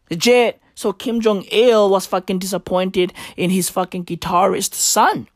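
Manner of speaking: ordinary talk, nothing marked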